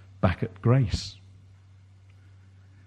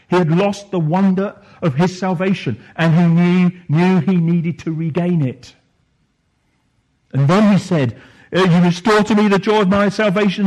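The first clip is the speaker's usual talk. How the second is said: higher and louder, driving preacher voice; full-force preaching; 7 semitones higher, 7 dB louder than usual. Acted. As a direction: build the sermon to a fervent peak. Preaching climax on David's plea.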